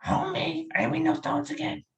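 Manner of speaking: high-pitched cartoon wife impression; Marge Simpson. Impressionist quizzing a stranger, high Marge Simpson impression. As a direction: nag your husband in a high cartoon voice. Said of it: "see if you can do Marge".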